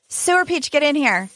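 high-pitched call to a friend; high, eager summons; calling someone over. Real, not acted. Calling a passerby onto the show.

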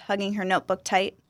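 plain reading voice

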